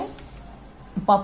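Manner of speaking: प breathed out without voice; a soft voiceless प, just air; voiceless puff demo. Shown as a demonstration. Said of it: "फ, ब, व वाला नहीं है".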